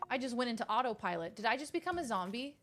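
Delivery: usual speaking voice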